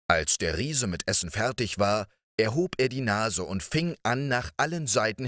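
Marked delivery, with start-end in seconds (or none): none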